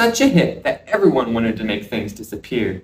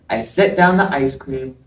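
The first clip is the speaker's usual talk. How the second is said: ordinary delivery, nothing marked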